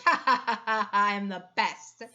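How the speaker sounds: loud mock-gloating laugh over the words. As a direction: crow it out like a champion laughing at the table.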